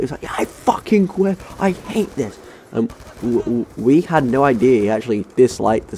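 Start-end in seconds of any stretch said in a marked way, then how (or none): 0.2-2.4 very high-pitched mock scream of quitting. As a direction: imitate the teacher shrieking, without real loudness. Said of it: "he screamed like that".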